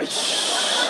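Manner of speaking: soft unvoiced shush of disgust; a quiet disgusted hiss. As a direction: shush like clearing smoke away. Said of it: "just like".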